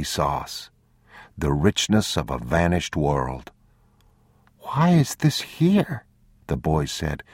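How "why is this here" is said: put-on high, slow, puzzled child's voice; young boy. A narrator's reading. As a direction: wonder aloud like a small boy.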